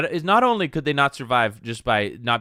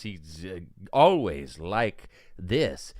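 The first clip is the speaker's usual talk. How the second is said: slow halting Goldblum drawl with odd pauses; Jeff Goldblum impression; 8 semitones lower, 4 dB quieter, much slower than usual. Streamer imitates the Jurassic Park actor to chat, slow and halting. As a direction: stretch it out, pausing mid-phrase like Goldblum. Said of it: "He talks like this".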